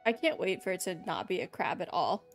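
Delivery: normal talking voice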